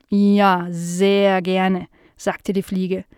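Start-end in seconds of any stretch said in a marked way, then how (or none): 0.1-1.9 slow housefly with drawn-out buzzing s sounds; curious housefly. Acted. Fly meeting Nala for the first time, slow and buzzy. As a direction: stretch the words like a dopey, curious fly.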